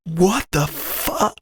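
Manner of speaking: high, soft, drawn-out mock disbelief; stunned disbelief. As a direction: act out stunned shock, very slowly and softly. Retold reaction to being fired.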